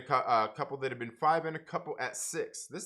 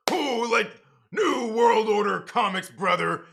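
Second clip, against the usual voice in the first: much louder and higher, slow roaring wrestler promo; 7 semitones higher, 9 dB louder, slower than usual. Pretend. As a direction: hype it up like a wrestler hollering brother. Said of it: "Now say that in the wrestler voice".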